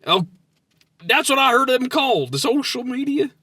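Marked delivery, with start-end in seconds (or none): none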